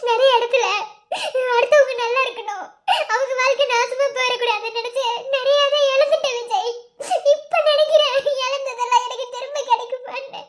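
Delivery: regretful words through sobs; crying. Acted. Wife regretting all she has lost, crying to her husband. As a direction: sob your way through a confession. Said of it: "இப்ப எதுக்கு நீ அழுதுகிட்டு இருக்க".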